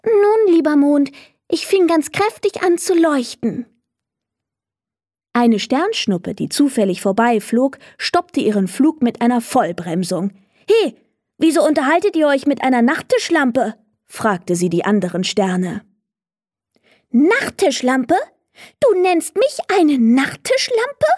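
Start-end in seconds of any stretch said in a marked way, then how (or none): 0.0-3.7 a small star boasting to the moon; little star. Acted. Little star answers the moon proudly in a higher voice. 10.7-13.7 fast, higher, mocking shooting star; a brash shooting star teasing; cheeky shooting star. Shooting star mocks the other stars, fast and higher. 17.1-21.1 a star insulted and fuming; offended star. Acted. Little star indignant at the shooting star, higher voice. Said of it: "Der helle Stern war mächtig wütend".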